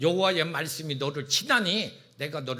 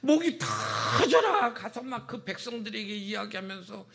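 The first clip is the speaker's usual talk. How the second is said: ordinary talk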